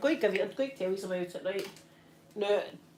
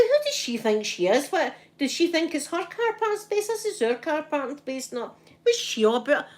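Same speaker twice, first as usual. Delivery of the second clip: indignant; 7 semitones higher than usual. Retold or imitated. Retelling her own outrage.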